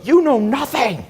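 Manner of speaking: high, slow, sneering mimic of the Harvard friend; mocking snooty friend. Retelling the friend's dinner outburst, high mocking sneer. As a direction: imitate her looking down her nose, high and drawn out. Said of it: "She flipped out at a dinner".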